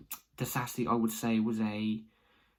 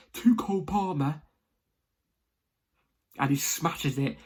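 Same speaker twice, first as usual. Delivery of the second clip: excited; 4 semitones higher, 4 dB louder than usual. Retold or imitated. Reliving the goal with excitement.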